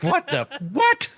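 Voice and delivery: stunned disbelief, stretched out; baffled. Retold or imitated. Bewildered paramedic to the clerk, high and slow. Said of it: "I was like".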